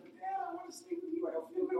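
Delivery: high, pleading child's voice, lightly imitated; little daughter's plea. Pastor imitating his little daughter's high plea. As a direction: play the small daughter asking to sleep in the big bed.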